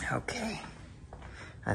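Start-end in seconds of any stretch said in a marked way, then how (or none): 0.1-0.6 high soft whisper; whispered okay. Real, not acted. Vlogger wrapping up the tour, whispered okay. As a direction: breathe it out under your breath. Quiet aside before wrap-up.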